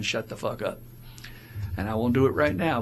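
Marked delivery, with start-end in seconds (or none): none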